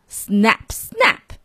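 playful menacing snaps; snapping turtle. A narrator's reading. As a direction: bite out each word like jaws closing. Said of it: "snapped the snapper".

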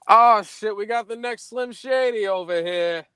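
imitating a cop's high-pitched taunt; mocking jeer. Cop makes fun of the teller's rapper chain, jeering.

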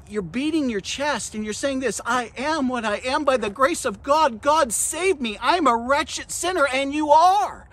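forceful sermon, rising in pitch and drawing out key words; impassioned preaching. Acted. Preacher telling believers they are nothing without Christ, impassioned preaching. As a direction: hammer it home to the congregation.

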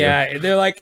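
ordinary delivery

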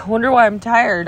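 ordinary talk, nothing marked

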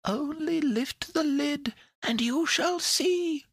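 very high, slow, coaxing little voice; sweet little voice. A narrator's reading. A tiny creature coaxes Pandora from inside the box, high and sweet. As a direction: pipe it sweetly and slowly, high above your own. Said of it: "said the sweet little voice again".

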